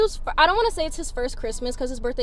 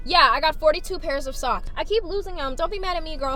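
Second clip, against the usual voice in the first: ordinary delivery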